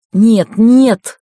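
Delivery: loud frightened protest; panicked cry. A narrator's reading. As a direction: cry out no in terror. Dying woman refuses help.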